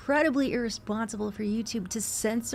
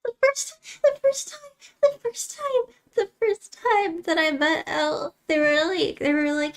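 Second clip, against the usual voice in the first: choked up; 7 semitones higher, 5 dB louder than usual. Real, not acted. Emotional birthday memory.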